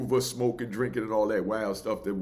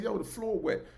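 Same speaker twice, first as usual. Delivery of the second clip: gentle George; 9 semitones higher, 5 dB quieter than usual. Retold or imitated. Mild George warning softly about his wet floor.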